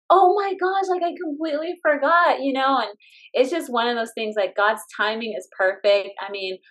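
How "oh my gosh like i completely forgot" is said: high-pitched, amazed remembering; astonished, higher-pitched recollection; retold realization. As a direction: replay it suddenly dawning on you. Retelling her sudden realization.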